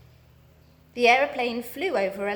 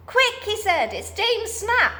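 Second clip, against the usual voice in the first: high, urgent warning; alarmed urgency; 11 semitones higher, 4 dB louder than usual. A narrator's reading. Moonface warns the children to flee. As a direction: hurry the others off in alarm.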